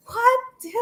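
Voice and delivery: high-pitched, incredulous reaction; acted-out high yelp of bafflement; mock disbelief. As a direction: squeak it out, baffled by what you heard. Baffled reaction to others' talk acted out.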